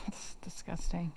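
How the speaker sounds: slow breathy whisper of disgust. Real, not acted. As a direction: recoil and breathe it out slowly. Reacting to gross proposal story.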